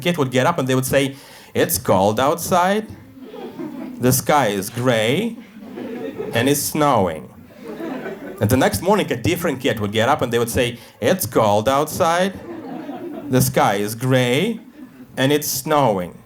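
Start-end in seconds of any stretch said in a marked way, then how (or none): 1.5-7.2 slow, sing-song schoolkid recitation; rote class recital. Retold or imitated. Kids reciting weather in class. 11.0-16.1 slow, sing-song schoolkid recitation; rote class recital. Retold or imitated. Next kid recites same lines.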